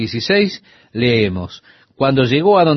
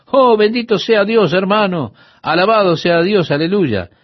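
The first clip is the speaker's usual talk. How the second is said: high-pitched, unbroken mock praise; raised, run-on imitation of effusive believers; imitating rapid talkers; 6 semitones higher than usual. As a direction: mimic pious men rattling off blessings over a question. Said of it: "y hablaban tan rápido".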